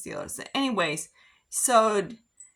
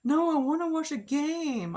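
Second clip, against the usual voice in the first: higher mock voice of a grown man acting two; Jason's tantrum voice; 10 semitones higher, slower than usual. Boyfriend refusing to leave the game, mock childish whine, pitched up. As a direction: imitate him whining like a two-year-old. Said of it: "So he's like".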